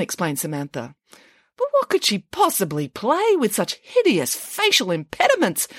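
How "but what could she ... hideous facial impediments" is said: higher, lightly voiced Nanny Piggins; scandalised question in a high voice; a hint of pig voice. A narrator's reading. Nanny Piggins asks the children, high and scandalised. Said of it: "asked Nanny Piggins".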